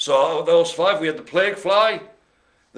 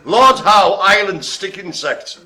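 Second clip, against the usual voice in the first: slow, loud, higher mock posh accent; mid posh accent; 4 semitones higher, 6 dB louder, slower than usual. Host announcing the insect in a mock posh accent. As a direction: put on a posh accent, word by word. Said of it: "It's how to do this in a mid posh accent".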